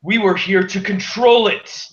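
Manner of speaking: a vampire scolding the party from above, loud and high; booming vampire. Acted. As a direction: boom down from the sky like a scornful vampire lord. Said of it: "in a voice much louder than one would expect".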